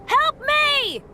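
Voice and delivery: high, loud desperate cry for help; calling for help. Acted. Trapped adventurer shouting for rescue, desperate. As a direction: shout for help from inside a cage.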